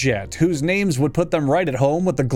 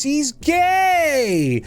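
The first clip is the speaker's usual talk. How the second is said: mock flamboyant voice; 9 semitones higher than usual.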